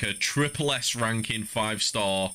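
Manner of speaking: ordinary talk, nothing marked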